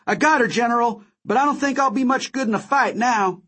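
high-pitched urgent report; crying out. A narrator's reading. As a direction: call it out high to the General. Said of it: "Wody cried".

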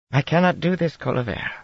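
a hint of Tarabana accent. A narrator's reading. Aes Sedai refusing the queen, light Tarabana accent. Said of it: "in a Tarabana accent".

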